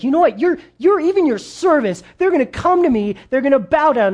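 high, loud, fed-up rant at Pharaoh; exasperated outburst. Retold or imitated. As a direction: scold him like an angry prophet who has run out of patience. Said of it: "and then he's like".

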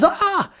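sharp high gasp on seeing the lost boat; a child's startled gasp, pitched high; high gasp of surprise. Acted. Boy sees his boat in the shop window, high gasp.

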